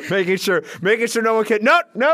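high, loud mock shepherd shooing sharks; biblical shepherd. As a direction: call out urgently as a pretend shepherd. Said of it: "like an old biblical shepherd".

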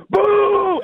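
boo like a hostile Philly crowd; imitated crowd boo. Crowd booing him on stage.

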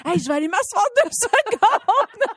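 high-pitched mock exhaustion, breaking into laughter; worn-out mom. Mom bailing out of a game with her child, mock worn-out. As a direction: act out giving up on playtime, laughing. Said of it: "je suis pas capable de la suivre".

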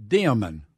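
DEMON stretched into DEE-uh-mun, loud; three-syllable demon. Shown as a demonstration. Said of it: "that is pronounced".

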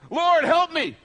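high, loud plea for help; pleading cry. Retold or imitated. Man on the cliff begging the Lord, high and loud. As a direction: beg the Lord from the branch.

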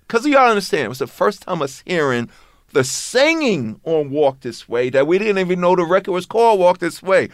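ordinary talk, nothing marked

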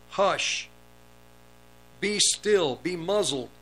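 a stern rebuke hurled at the wind; rebuking command. Acted. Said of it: "rebuked the wind and said to the sea".

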